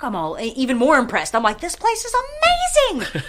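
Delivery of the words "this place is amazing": squealed much higher; over-the-top amazement. Retold or imitated. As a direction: gush like a thrilled fan. Retelling being impressed.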